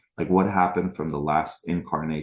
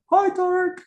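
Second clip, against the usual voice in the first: very high cartoon squeak, teasing; mocking squeaky voice; 8 dB louder than usual. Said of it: "They're like".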